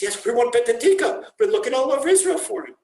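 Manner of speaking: high, loud and fast, pressing the point; eager insistence. Acted. Students insisting to a doubtful passerby, eager and fast. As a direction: insist eagerly that you mean it.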